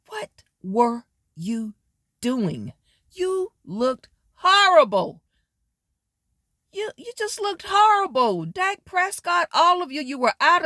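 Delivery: incredulous, scolding a team that let you down; exasperated scolding. Real, not acted. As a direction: let the disgust stretch the words out high. Scolding the Cowboys after a loss.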